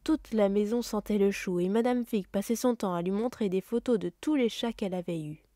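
usual speaking voice

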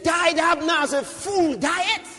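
high, loud cry of disbelief; preacher dramatizing a king's outcry; outraged lament. Acted. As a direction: cry it out as a shocked question. Acting David's outcry over Abner.

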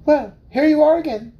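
high, eager little mouse; squeaky small mouse voice. Acted.

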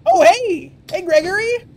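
high, loud greeting; excited calling out. Pretend. Player hailing the guard Gregory, high excited shout. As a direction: hail him from across the checkpoint.

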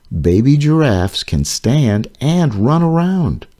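ordinary delivery, nothing marked

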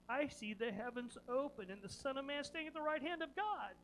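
ordinary talk

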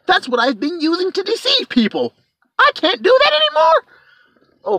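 high, rising, distressed dragon voice; panicked dragon. Retold or imitated. Dragon losing its microphone, high mock panic. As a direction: squeal in mock panic as the tricks fail. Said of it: "He was like".

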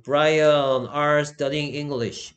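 extremely slow reading of the wrong sentence; very slow wrong example. Shown as a demonstration. Teacher reading the wrong example to the class, very slow. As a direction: drag out the wrong version. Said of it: "틀린 부분이 있는데".

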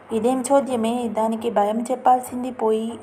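ordinary delivery

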